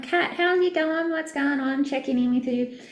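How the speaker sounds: lightly voiced cheerful check-in to a client; cheery check-in. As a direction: sing out a friendly hello. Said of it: "I'm like".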